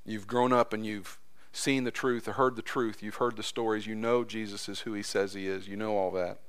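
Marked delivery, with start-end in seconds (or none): none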